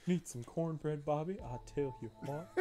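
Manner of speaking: lightly done King of the Hill Texan twang; a hint of Hank Hill asking Bobby; King of the Hill accent. Asking Bobby about cornbread in a bad King of the Hill accent. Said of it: "terrible King of the Hill accent".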